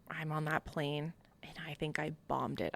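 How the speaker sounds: near-whispered, soft admission; hushed defeat. Retold or imitated. Recruit flying home after a bad interview, hushed defeat. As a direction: drop to a low-volume, defeated murmur.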